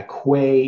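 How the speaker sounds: quay with an audible w, "kway". Shown as a demonstration. Agreeing on a variant, quay pronounced "kway". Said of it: "it's sometimes pronounced that".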